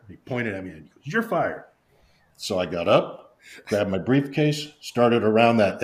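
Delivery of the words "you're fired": much higher, falling retold dismissal; mock firing. Boss pointing at his employee to fire him, mock indignant. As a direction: start high and drop, imitating the boss.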